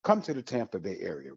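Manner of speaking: usual speaking voice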